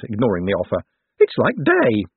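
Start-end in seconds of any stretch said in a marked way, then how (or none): 1.2-2.0 high-pitched wonder; exclaiming Owlswick. A narrator's reading. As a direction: marvel in a high little-man voice. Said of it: "Owlswick exclaimed".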